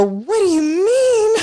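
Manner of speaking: high, slow, drawn-out disbelief; stunned disbelief. Retold or imitated. Retelling her stunned reply to her friend, slow high disbelief. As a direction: stretch it out in high shock. Retold shock at friend's words.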